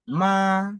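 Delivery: "Ma" held long, high and level; first tone. Shown as a demonstration. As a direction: keep "Ma" high and even, no movement. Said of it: "le premier ton".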